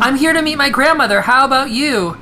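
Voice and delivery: high, loud, put-on teen voice; high squeaky voice. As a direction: squeak out like a cartoon kid. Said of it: "are they both gonna sound like that?".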